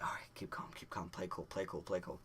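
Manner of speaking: soft, repeated muttered pep talk; under the breath, half whispered; hushed self-talk. Retold or imitated. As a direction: mutter it to keep yourself composed. Self-talk before reaching the window.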